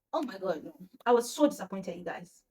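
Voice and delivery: ordinary delivery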